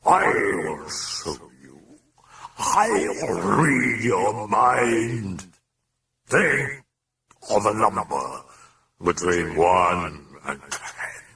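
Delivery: slow, drawn-out demon voice; taunting demon. A narrator's reading. Demon offers to read the priest's mind, slow menace. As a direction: stretch each word, commanding and eerie.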